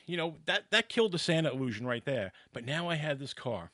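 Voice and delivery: normal talking voice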